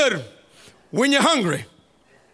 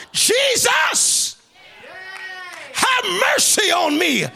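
high, full-throated preacher's plea; desperate crying out; 7 semitones higher, slower than usual. Acted. Mother begging Jesus for her daughter, crying out. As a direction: cry out for mercy, voice pitched way up. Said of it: "cried out".

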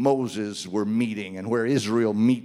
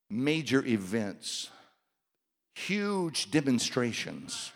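ordinary delivery

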